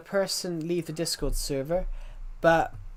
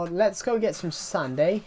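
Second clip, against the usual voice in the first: mock Aussie accent, lightly done; Australian accent. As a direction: put on a playful Aussie twang while building. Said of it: "it's an Australian accent".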